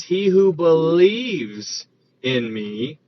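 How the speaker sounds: "believes" drawn out long and emphatic; stress on BELIEVES. Shown as a demonstration. Preacher correcting a misquote of the verse, stress on "believes". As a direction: lean hard and slowly on "believes". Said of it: "emphasis on the believes in that verse".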